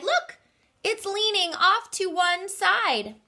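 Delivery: put-on very high child voices; children crying out. Rabbit children pointing at the leaning tree, very high. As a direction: squeal it high like little kids. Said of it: "the children cried".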